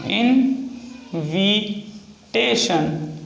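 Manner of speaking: extremely slowed dictation; very slow stretched word. Shown as a demonstration. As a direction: pull the word out syllable by syllable. Repeats word while writing.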